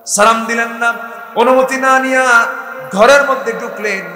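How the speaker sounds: loud, high accusation; woman accusing the intruder, loud and high. Acted. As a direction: hammer each fault home loudly. Woman listing his faults.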